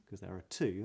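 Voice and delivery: ordinary delivery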